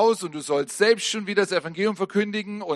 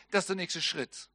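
usual speaking voice

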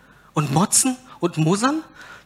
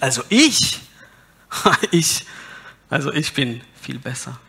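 mock smugness. Mock self-righteous listener.

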